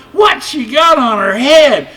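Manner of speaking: very high, loud jeering shout; high loud taunting lamb. A narrator's reading. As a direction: yell it like a mocking young lamb. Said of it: "shouted one".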